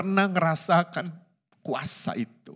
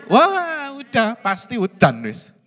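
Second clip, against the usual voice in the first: mock surprise; 5 semitones higher, 6 dB louder, slower than usual. Preacher mimics seeing rain clouds.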